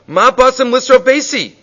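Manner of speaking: high, loud alarmed cry; outraged father yelling high and loud; screaming in Aramaic. Retold or imitated. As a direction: shout the accusation in Aramaic. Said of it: "He starts screaming at them".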